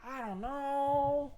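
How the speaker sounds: very high, slow singsong with a long held KNOW; drawn-out high-pitched cluelessness; exaggerated stumped wail. Real, not acted. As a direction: stretch KNOW out on a high note. Stumped, repeats it more exaggeratedly.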